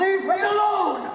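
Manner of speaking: shouted high and loud, drawn out; angry shout. Acted. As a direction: explode at them, slow and furious. Fed-up outburst at pushy fans.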